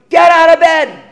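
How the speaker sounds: mom yelling. Retold or imitated. Mom yelling at the kids to get out of bed. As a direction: shout up the stairs like a mom.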